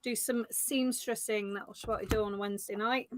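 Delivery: usual speaking voice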